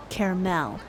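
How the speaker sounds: caramel said "kair-MEL"; last-syllable stress. Shown as a demonstration. Streamer listing the versions, final stress. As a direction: put the weight on the MEL of caramel. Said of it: "I put the emphasis on the last syllable".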